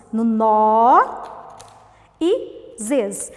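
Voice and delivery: "nó" loud and stretched, pause, then "zez"; syllable by syllable. Shown as a demonstration. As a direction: hold nó, then give zez separately. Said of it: "nós separamos a sílaba". (nó e zez, sílabas bem separadas)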